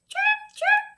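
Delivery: high, squeaky chirp chirp; bird chirp. Acted. Librarian imitates the baby bird for children, with high squeaky chirps. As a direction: chirp like a tiny hungry bird.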